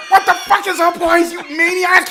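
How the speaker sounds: high-pitched exasperated cry; mock baffled fury without much volume; incredulous outburst. Son questioning dad's nonsense order, incredulous.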